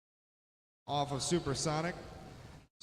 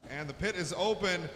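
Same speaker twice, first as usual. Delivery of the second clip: ordinary talk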